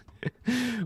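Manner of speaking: high burst of laughter; real laugh. Real, not acted. Streamer to chat, laughing at Twitter drama. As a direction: crack up at the absurdity.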